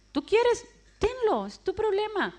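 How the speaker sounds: voicing the old guerrilla trainer, high and dismissive; shrugging mentor. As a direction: toss it back to her, offhand and emphatic. Old guerrilla fighter quoted advising her.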